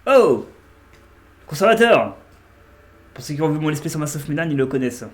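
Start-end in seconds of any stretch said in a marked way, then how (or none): 0.1-2.2 loud, high joyful call; excited greeting. Real, not acted. Streamer greeting the Curator, loud and delighted.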